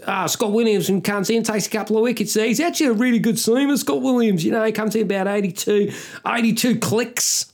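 high, nasal Australian cricket commentator; nasal Aussie. Acted. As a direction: put on a whiny, high-pitched Australian accent. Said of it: "one of those fucking nasal Aussies".

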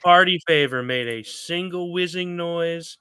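hammed-up sob-story narration; mock pathos. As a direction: declaim it like a great tragedy. Continues mock-tragic tease.

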